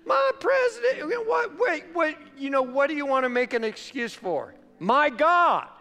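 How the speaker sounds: high, fussy mock complaint; hammed-up whining about the president; whiny excuse maker.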